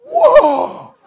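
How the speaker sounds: high, loud exaggerated whoa; a mocking, over-the-top gasp of shock. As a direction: ham up the shocked outsider. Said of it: "They're just like".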